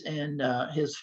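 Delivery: usual speaking voice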